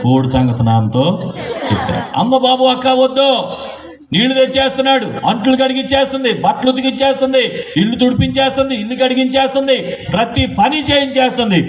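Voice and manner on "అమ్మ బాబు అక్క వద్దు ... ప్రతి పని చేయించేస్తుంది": put-on high, whiny crying boy voice; wailing little boy. Little brother whining to his sister about chores, high and tearful. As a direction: wail out the list of chores like a bullied kid.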